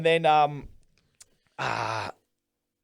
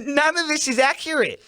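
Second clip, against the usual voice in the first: exasperated; 9 semitones higher than usual. Retold or imitated. Exasperated reaction to the news.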